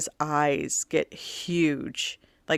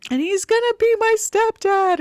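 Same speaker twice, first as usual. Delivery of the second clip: very high, louder comic sobbing; mock bawling wail; 5 dB louder than usual. Daughter sobbing a joke to mom, high loud wail. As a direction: wail it like a weeping teen. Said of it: "she's just bawling".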